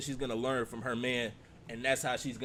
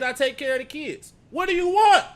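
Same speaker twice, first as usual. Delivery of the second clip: loud, high, mocking repeat of her words; high loud disbelieving quote; 13 semitones higher, 12 dB louder than usual. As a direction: blast it back at her in disbelief. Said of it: "She said".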